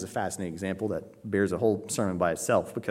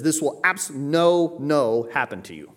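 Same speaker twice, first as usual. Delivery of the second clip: first "no" raised high and loud; emphatic double negative; 4 semitones higher, 4 dB louder, slower than usual. Shown as a demonstration. Preacher showing Greek double negative, emphatic on "no". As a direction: punch "no" high, then come down on the repeat. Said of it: "If you want to emphasize something in Greek ... you double it".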